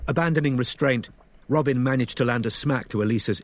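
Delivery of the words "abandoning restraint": ordinary delivery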